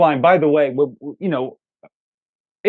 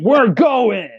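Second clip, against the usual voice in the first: mock shout; 7 semitones higher than usual. Mock action hero line.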